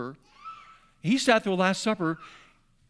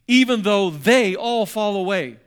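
louder, slow proclaiming; bold vow; 8 dB louder, slower than usual. Acted. Peter boasting to Jesus at the table, slow loud vow. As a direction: declare it slowly to the crowd.

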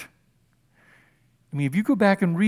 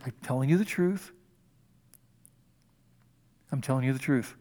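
hushed and earnest; 5 semitones lower, 6 dB quieter than usual. Shown as a demonstration. Preacher to congregation, a soft, solemn repeat. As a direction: lean in and let the truth land. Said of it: "Don't rush through this".

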